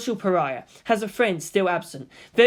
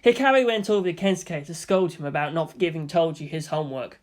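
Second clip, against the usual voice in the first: ordinary delivery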